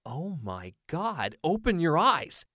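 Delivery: slow gasp then a loud high burst; can't believe it, shout it out; stunned kid yelling for his friend to look; amazed excitement. Acted. Owen amazed at correct guess.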